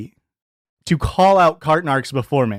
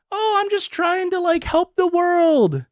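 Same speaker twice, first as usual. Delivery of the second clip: much higher, slowed, sarcastic fake innocence; mocking high whine; 12 semitones higher, slower than usual. Mocking the YouTuber who claims to help the world, much higher and slow. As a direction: drawl it out high and mock-innocent. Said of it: "he's trying to claim, like, he's a good dude".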